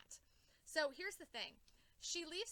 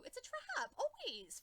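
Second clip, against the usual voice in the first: much higher, sing-song warning; high mock warning; 12 semitones higher than usual. Fan warning the hero about traps, high pitched. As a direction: squeal it like a warning. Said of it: "And I'm like".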